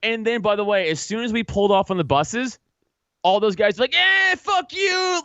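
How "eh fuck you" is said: high, slow, drawn-out sneer; mock jeer. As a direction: jeer like a cartoon bully from safety. Mocking guys jeering at departing buses.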